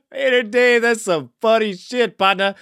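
normal talking voice

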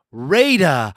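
drawn-out announcer intro. Acted. Host introduces the next caller.

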